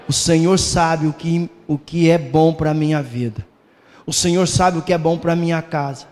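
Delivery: ordinary delivery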